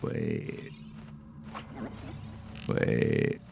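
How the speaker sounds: breathy, unvoiced "Wait."; a puzzled whisper of "Wait.". Real, not acted.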